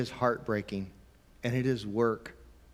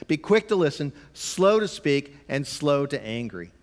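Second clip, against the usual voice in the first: Be quick to listen, slow to speak, with slow stretched and raised; scripture read with stress; 2 semitones higher, 4 dB louder than usual. Shown as a demonstration. Preacher reading James to parents, stressing slow. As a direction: lean hard on quick and slow, drawing slow out. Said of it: "James chapter one".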